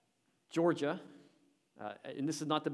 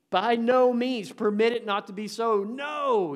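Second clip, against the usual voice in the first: emphatic proclamation; 9 semitones higher, 10 dB louder, slower than usual. Acted. Preacher quoting Paul emphatically.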